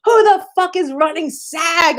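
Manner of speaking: high, slow outraged demand; mock furious. Ranting at Twitter over the child star's pay, high outrage. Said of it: "I went off and I was like".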